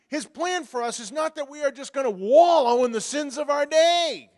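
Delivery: loud, rising sermon exhortation; fervent preaching. Acted. Pastor exhorts the congregation to follow Jesus, loud and fervent. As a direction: drive it home from the pulpit.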